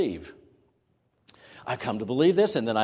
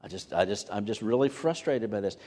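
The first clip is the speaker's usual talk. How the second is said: much lower and softer, deflated grumble; a believer grumbling that life never goes his way; frustrated moan; 5 semitones lower, 6 dB quieter than usual. Retold or imitated. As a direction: sag under it all, worn out. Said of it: "we go into frustration mode".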